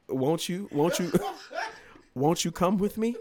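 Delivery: high, laughing mock-seductive plea; sing-song invitation broken by laughter. High and laughing, inviting his ex along.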